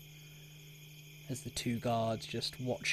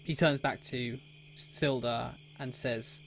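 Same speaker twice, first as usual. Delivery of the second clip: ordinary talk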